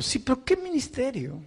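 high, pleading complaint back to his own heart; anguished questioning. Retold or imitated. Soaked pastor arguing with his own heart, high and pleading. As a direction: cry out the questions, high and hurting. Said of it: "Mi, mi corazón me decía".

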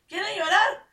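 high, loud taunting question; mother daring her crying kids; mocking threat.

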